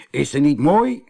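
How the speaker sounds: proud, admiring question. A narrator's reading. Husband showing off the mermaid to his wife, proud. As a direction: show her off with pride. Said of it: "vroeg hij trots".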